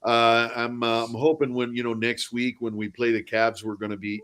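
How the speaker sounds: hockey-player Canadian, loud opening. Acted. Mock Canadian hockey player after a game, Canadian accent. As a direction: ham up a Canadian jock accent. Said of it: "when I'm confronted with a Canadian, I don't just immediately default to".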